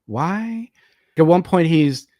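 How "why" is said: high, soft, rising disbelief; small bewildered questions, pitched way up; baffled. Retold or imitated. Baffled by the film's dialogue choices.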